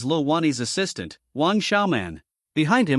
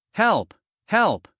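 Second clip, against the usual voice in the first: mock cry for help; 5 semitones higher than usual. A narrator's reading. Character bargaining in pain.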